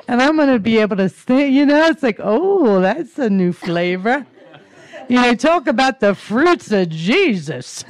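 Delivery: high, loud, bubbling with laughter; laughing delight. Real, not acted. Speaker joking about a new flavor, laughing out loud.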